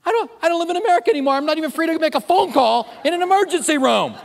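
high, fast, indignant outburst; mock outrage. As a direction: play up the outrage. Indignant rant about phone call.

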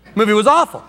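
disappointed moviegoer. Retold or imitated. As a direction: complain like the film cheated you. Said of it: "you go".

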